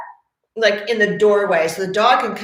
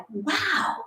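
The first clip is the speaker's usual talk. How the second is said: amazed; 10 semitones higher, 3 dB louder, slower than usual. Retold or imitated. Client amazed at yawning fact.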